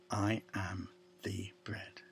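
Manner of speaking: whispered and soft, "I am the bread". Acted. Preacher whispering Jesus' words to the congregation. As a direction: breathe it like a secret.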